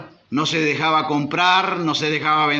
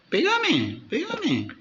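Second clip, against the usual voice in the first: taunting; 8 semitones higher, 3 dB quieter, much slower than usual. Retold or imitated. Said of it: "un niño que lo está desafiando".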